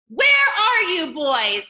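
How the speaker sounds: loud, slow angry call; booming father. Shown as a demonstration. Father searching for the boys, booming angry call. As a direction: make it fill the whole house. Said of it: "His voice went through every crack".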